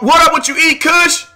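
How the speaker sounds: high, sudden burst of greeting; hyped, high-pitched hello; excited shout-out. Real, not acted. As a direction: break off and holler hello to a friend. Breaks off to greet a viewer.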